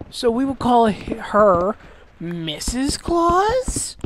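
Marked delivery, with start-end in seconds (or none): 2.2-4.0 very drawn-out, climbing question; feigned puzzlement, slow and rising high; sarcastic questioning. Real, not acted. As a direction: stretch it out as if puzzling over the obvious. Sarcastic question about female Santa.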